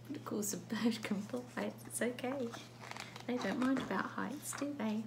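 usual speaking voice

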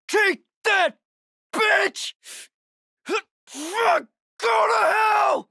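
high-pitched strained yelling while fighting; furious combat shouting. Acted. Fighter cursing the freaks mid-attack, furious strained shouting. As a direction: hurl curses at an attacker with full effort.

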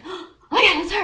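loud, high excited gasp; acted giddy freak-out. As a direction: burst out on spotting your crush. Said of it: "And I was freaking out".

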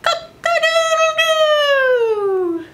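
high, drawn-out cock-a-doodle-doo; a rooster waking the farm; rooster crow. Acted. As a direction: crow like a proud rooster at dawn. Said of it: "the rooster crowed".

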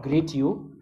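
ordinary delivery

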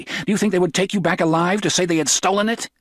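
high, fast, urgent hoarse voice; cried hoarsely. A narrator's reading. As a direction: rush it out, hoarse and pleading. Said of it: "she cried hoarsely".

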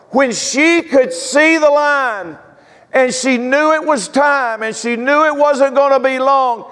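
high, drawn-out sermon climax; full-force preaching. Acted. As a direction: preach her victory, stretching the big words. Eulogizing mother in full preaching.

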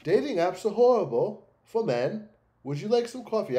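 high, loud mimicry of a European accent; mock European accent. Streamer mocks the dating video's narrator, put-on European accent. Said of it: "that accent that he has".

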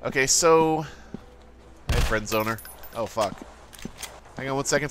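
ordinary talk

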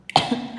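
sudden choking cough; choking on a drink. Real, not acted. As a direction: cough as the drink goes down wrong. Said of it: "até engasguei".

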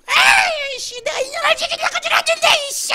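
high, loud demonic screech; strained, furious squeal; cursing demon. Acted. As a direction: shriek the threat as a murderous demon. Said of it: "막 욕을 하면서".

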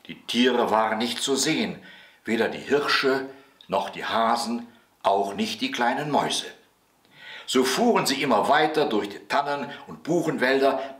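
usual speaking voice

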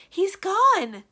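very high, loud anguished cry; anguish and disbelief. Acted. Witch finding her familiar has vanished, anguished disbelief. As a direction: wail it in disbelief. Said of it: "in a voice so full of anguish and disbelief".

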